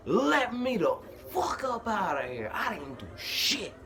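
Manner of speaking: high, drawn-out mock scream; retold screaming. Detainee yelling into the interrogation room speaker, imitated scream. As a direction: act out screaming to be released, slowly. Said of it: "I'm screaming in that bitch".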